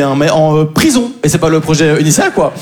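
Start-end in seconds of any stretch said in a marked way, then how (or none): none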